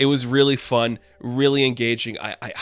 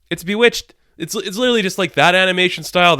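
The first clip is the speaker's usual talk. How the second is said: high, louder excited repeat; 6 semitones higher, 5 dB louder than usual. Real, not acted. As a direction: burst out with delight, rising.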